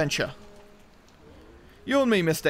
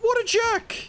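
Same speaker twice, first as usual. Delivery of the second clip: very high, squeaky outrage; hammed-up falsetto offense; mock indignation. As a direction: squeak it out, comically affronted. Mock outrage at the game monster.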